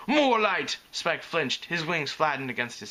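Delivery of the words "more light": put-on high, loud accented command; barked order. A narrator's reading. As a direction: demand it like a bossy diva. Photographer barks at crew again.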